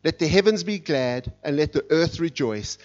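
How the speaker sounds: normal talking voice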